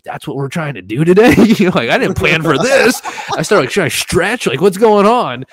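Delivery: high, loud nervous panic; panicked disbelief. Retold or imitated. Tourist panicking about facing the bull, high and loud. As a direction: squeal in disbelief.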